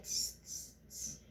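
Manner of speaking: short unvoiced teasing tss, tss, tss; mock the peddler like a cheeky monkey; monkey tss sounds.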